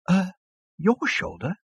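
understated horror; falter, then query it in disbelief; horrified. Acted. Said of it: "in a horrified whisper".

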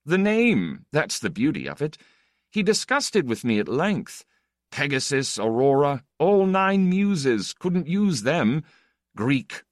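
high, animated aristocratic raconteur; flippant Count anecdote. Acted. The Count tells of naming a magazine, flippantly. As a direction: relish the gossip, stretching the French titles.

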